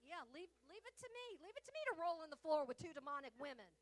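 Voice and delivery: fast, loud, sarcastic grumble; a fast and loud sarcastic complaint; sarcastic quip. Retold or imitated. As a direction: throw it back with loud sarcasm. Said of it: "he was teasing".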